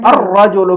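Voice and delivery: الرجل as ar-rajulu, rolled doubled R; assimilated article. Shown as a demonstration. Teacher repeats answer for pupil, الرجل with rolled R. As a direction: glide from A into a trilled R in الرجل, no L. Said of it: "تو اب اس کو پڑھیں گے".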